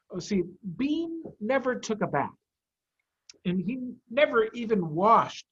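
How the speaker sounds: plain reading voice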